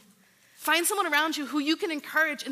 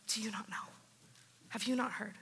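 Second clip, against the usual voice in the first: gentle whisper; 4 semitones lower, 11 dB quieter than usual. Acted. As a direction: whisper softly, then ease back to voice. Comforting someone through a trial.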